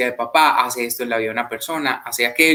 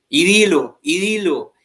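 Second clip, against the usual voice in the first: urging preacher; 5 semitones higher, slower than usual. Retold or imitated. Missionary urging him to say it.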